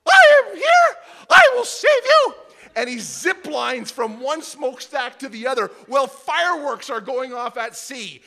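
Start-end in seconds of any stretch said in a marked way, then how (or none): 0.1-2.3 very high loud cartoon hero voice; Mickey Mouse. Pastor voicing Mickey Mouse arriving to save everyone. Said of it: "and Mickey goes".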